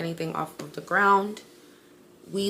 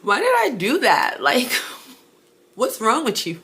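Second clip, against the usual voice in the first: pitched up, louder, half laughing; laughing self-scold; 5 semitones higher, 6 dB louder than usual. Real, not acted. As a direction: scold yourself through a laugh. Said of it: "scared now".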